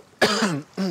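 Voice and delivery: coughing on a bug in the throat; throat-clearing cough. Real, not acted. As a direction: clear something stuck in the throat. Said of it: "J'ai encore un moustique dans la gorge".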